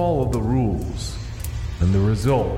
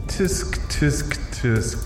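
tsk tsk; 11 semitones lower, much slower than usual. Acted. Host scolds camper.

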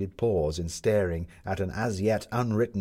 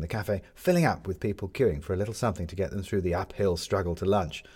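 ordinary delivery

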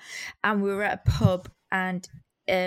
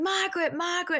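calling the dog's name up high, mock shout; retold shouting; 7 semitones higher than usual. Drunk couple calling for their lost dog, mild mock shout. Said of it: "and shouting".

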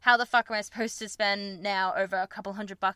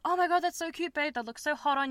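higher, delighted mock gush; a light impression of a smitten partner; 6 semitones higher than usual. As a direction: play the boyfriend cooing over cute underwear. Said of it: "and be like".

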